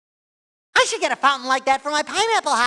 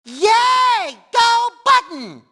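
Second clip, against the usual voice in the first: high, loud, drawn-out hooray; jubilant cheer; 10 semitones higher, 5 dB louder, much slower than usual. Acted. Cheering on the button, jubilant yell.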